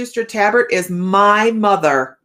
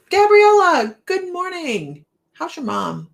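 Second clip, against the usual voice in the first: sing-song greeting; 11 semitones higher, slower than usual. Real, not acted. Reading viewer comment in sing-song.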